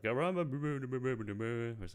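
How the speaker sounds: slow, slurred mock Cajun gibberish; a mock Cajun mumble, words smeared together; mumbled Cajun accent. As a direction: imitate a Cajun accent nobody can understand. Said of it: "Gambit's accent is barely understandable".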